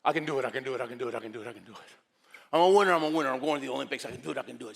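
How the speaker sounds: rapid chanting self-talk, surging and dropping; repetitive, urgent self-encouragement; pep talk. Acted. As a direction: rattle it off like a mantra to psych yourself up. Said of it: "I gotta give myself a pep talk".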